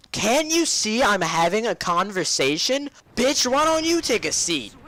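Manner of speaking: high, drawn-out sassy outburst; indignant backtalk. Retold or imitated. Student sassing his teacher mid-class, indignant and drawn-out. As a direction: stretch it out in mock outrage. Talking back at teacher in story.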